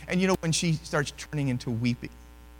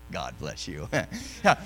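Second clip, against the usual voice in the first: ordinary delivery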